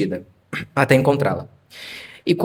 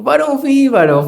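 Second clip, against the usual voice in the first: retold excitement; 11 semitones higher than usual. Proud reply to doubting friend.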